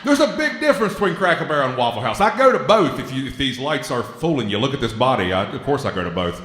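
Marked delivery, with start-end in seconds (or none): 0.0-3.3 excited. Real, not acted. Loud laughing comedic outburst.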